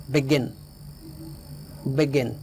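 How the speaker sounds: "begin" with the weight on "gin"; light "be", stressed "gin" in "begin". Shown as a demonstration. Teacher models "begin" for learners, stress on GIN. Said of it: "यहाँ stress है गिन पर".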